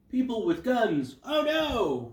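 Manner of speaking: high, loud, hammed-up panic; exaggerated cry of alarm; mock alarm. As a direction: act out spotting danger, slow then shriller. Acts out her alarmed reaction.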